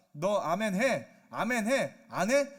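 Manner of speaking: loud, insistent mock order; forceful command. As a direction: press them hard to obey. God forcing someone to say amen. (윽박지르듯 크게 명령하는 말투)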